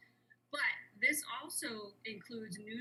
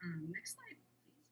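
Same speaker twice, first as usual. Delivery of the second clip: whispered aside; 3 semitones lower, 5 dB quieter, slower than usual. Real, not acted. Aside to slide operator.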